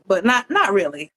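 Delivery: ordinary talk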